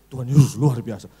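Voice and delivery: low, loud preacher voice; imitated preacher, deep and forceful; bassy pulpit preacher. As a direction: boom it out in a mock pulpit bass. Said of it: "Kok bisa beda sama ngobrol biasa?".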